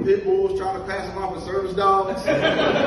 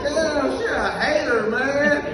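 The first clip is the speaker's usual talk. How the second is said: mock outrage; 8 semitones higher, 5 dB louder, much slower than usual. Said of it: "I said".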